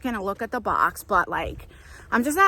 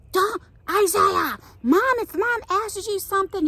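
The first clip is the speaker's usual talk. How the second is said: stern big brother; 9 semitones higher than usual. Retold or imitated. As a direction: scold the younger brother like a protective big brother. Older brother scolding, voiced.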